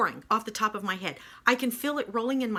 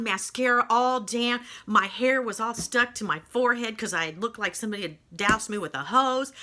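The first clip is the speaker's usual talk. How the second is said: ordinary talk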